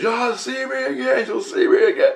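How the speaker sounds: very high sing-song warning; high parting threat. Retold or imitated. Giant being pulled back, threatening the dreamer, high sing-song. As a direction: call it out high as he's dragged away. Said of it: "and he goes".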